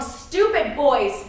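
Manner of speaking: normal talking voice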